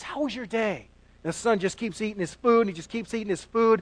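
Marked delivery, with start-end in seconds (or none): 0.0-0.9 soft fatherly question. Retold or imitated. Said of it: "He says".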